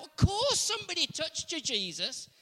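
mock disbelief at the question; exasperated disciples. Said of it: "the disciples will go".

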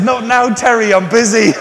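high, loud, laughing brush-off; breezy laughing dismissal; laughing reply. Retold or imitated. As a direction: toss it off while still laughing. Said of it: "I said".

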